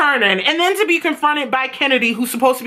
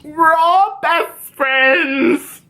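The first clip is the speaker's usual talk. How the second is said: higher, stretched-out gushing; imitating Jules; 6 semitones higher, much slower than usual. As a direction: drag out the words sweetly, pitched up. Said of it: "And she's like".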